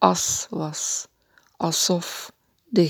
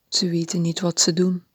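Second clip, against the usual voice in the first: usual speaking voice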